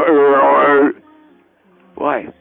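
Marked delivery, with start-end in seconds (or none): 0.0-1.0 loud grunting gorilla noise. Retold or imitated. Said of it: "we go".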